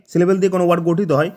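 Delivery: ordinary talk, nothing marked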